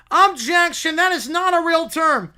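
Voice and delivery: loud, high courtroom objection; shouted objection. Acted. Opposing lawyer objecting to the judge, loud and high. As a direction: leap up and object.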